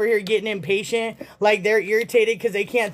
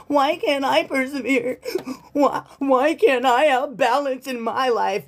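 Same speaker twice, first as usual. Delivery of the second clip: whiny ex; 4 semitones higher than usual. Retold or imitated. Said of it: "this person's like".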